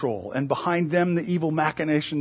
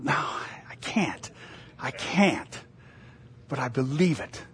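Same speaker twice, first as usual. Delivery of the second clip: whispered admission; 3 semitones lower, 5 dB quieter than usual. Real, not acted. Preacher answers the challenge to prove God, whispering. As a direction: concede it under your breath. Answers imagined heckler softly.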